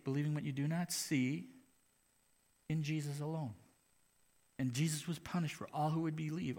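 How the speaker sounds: ordinary talk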